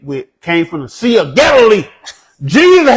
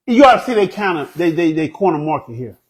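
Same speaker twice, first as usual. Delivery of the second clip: usual speaking voice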